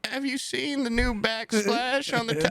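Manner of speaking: put-on high, sweet old-lady voice; grandma voice. Grandson imitates grandma asking about her painting, high and sweet. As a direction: mimic a frail grandma showing off. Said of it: "She's like".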